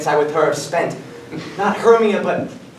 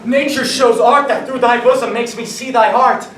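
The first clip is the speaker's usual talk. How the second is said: excited; 6 semitones higher, 3 dB louder than usual. Acted. Waking enchanted, sees Helena.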